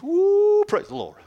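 sharp high woo then praise the Lord; high whoop. Shown as a demonstration. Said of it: "Get up to the two ones".

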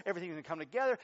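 normal talking voice

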